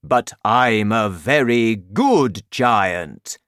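slow, loud giant declaration; loud protest. A narrator's reading. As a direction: proclaim it firmly, drawn out. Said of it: "the B F G said loudly".

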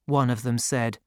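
plain reading voice

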